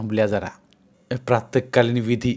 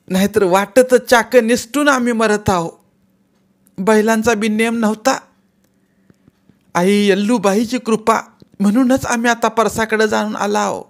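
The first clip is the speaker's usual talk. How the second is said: angry; 10 semitones higher, 6 dB louder than usual. Acted. Aunt keeps scolding.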